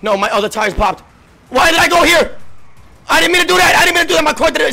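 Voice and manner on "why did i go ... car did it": loud, high frantic excuse; panicked protest. Real, not acted. Panicking over a driving mistake.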